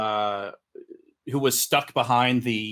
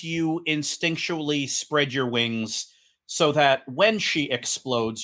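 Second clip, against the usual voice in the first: usual speaking voice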